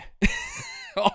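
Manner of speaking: real laugh breaking out in a high squeal; can't hold it in, squeal with laughter. Real, not acted. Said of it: "I'm laughing too much about it".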